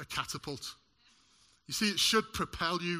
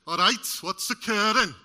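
lightly put-on Welsh accent, lifted and bright; a bad Welsh accent quoting a sitcom catchphrase; 4 semitones higher, 8 dB louder than usual. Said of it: "Terrible Welsh accent there".

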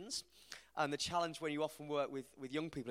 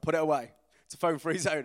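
firm, louder parental command; mock-stern parents; 2 semitones higher, 11 dB louder than usual. Parents telling son at the table, firm and louder. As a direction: lay down the house rule. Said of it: "We were like".

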